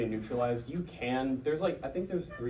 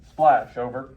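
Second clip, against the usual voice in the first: loud radio call; 12 dB louder, slower than usual. Shown as a demonstration. FDC radio call in drill.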